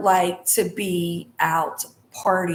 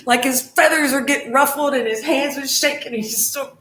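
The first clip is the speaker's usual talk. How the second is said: high, loud, quickened comic retelling; animated amusement; 8 semitones higher, 5 dB louder than usual. Retold or imitated. Describing her twin's ruffled tantrum, animated and amused. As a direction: tell it tickled, voice jumping up fast.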